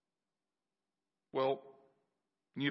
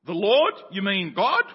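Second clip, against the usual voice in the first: loud, rising disbelief; astonished; 5 semitones higher, 11 dB louder than usual. Acted. Jack hanging from the branch, stunned that God answered. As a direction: shout it in disbelief.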